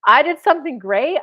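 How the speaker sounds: loud, swelling mock triumph; hammed-up chest-out boast; puffed-up pride. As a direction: imitate a man glowing with his own success. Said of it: "puffed his chest and felt like a man".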